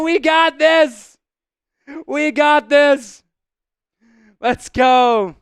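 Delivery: loud, excited rally cry, slow and stretched; hype shout. Acted. Streamer shouting a fan's message to the chat, hyped. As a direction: pump up the chat with a big shout.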